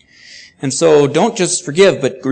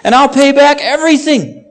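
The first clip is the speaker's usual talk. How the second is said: begging; 9 semitones higher, 5 dB louder than usual. A narrator's reading. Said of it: "he begged".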